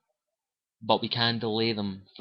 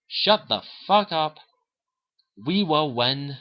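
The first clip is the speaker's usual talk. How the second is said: slow, higher and louder, defiant; defiant retort; 5 semitones higher, 4 dB louder, slower than usual. Retold or imitated. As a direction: spit it back at the Reaper, slowly. Shepard defying the Reaper Harbinger.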